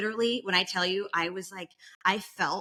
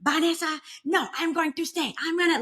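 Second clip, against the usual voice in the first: insistent mom; 9 semitones higher than usual. Retold or imitated.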